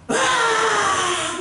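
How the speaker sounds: long high crying wail; mock wail. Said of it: "막 울고 막". (아이처럼 높게 엉엉 우는 흉내)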